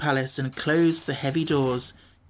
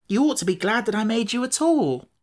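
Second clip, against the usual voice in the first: indignant; 7 semitones higher than usual. A narrator's reading. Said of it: "returned Tip indignantly".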